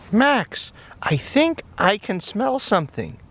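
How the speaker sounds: high, bright puppy voice, eager and friendly; high-voiced cheerful puppy; little dog voice. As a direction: play the small excited dog, pitched well up. Toy dog Max voiced in play.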